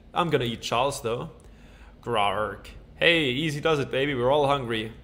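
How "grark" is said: low growling dinosaur grunt. Pretend. Player voicing his dinosaur, low growl.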